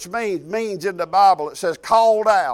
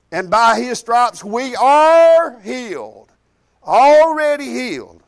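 slow, loud, higher proclamation; preaching at full force; 4 semitones higher, 6 dB louder, slower than usual. Acted. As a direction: declare it slowly like the climax of a sermon. Preacher's climactic declaration.

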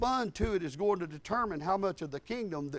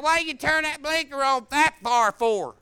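nagging; 8 semitones higher, 9 dB louder than usual. Retold or imitated. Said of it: "She's like".

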